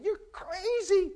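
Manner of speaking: high, slow mocking voice; disciples mocking. Disciples scoffing at Peter from the boat, high and slow. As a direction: draw it out high like scoffing friends. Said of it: "the other disciples are saying".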